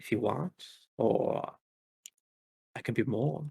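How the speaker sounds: sultry villainess murmuring an offer; soft seductive villain. Acted. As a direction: lure them in gently. Red Recluse flirts.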